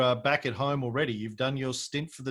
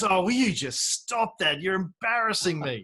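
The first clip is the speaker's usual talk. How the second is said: slow, higher pleading wife, mildly mock; exasperated wife; 7 semitones higher, 3 dB louder than usual. Host imitating his American wife scolding him, higher and slow. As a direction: play the embarrassed wife, drawn out. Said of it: "she goes".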